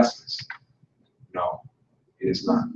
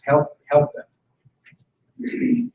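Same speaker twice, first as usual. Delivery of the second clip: slow pleading; 3 semitones higher, much slower than usual. Real, not acted. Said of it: "speaker urges instructors to help".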